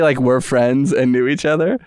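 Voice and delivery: ordinary talk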